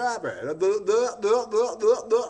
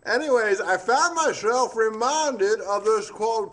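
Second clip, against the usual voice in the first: normal talking voice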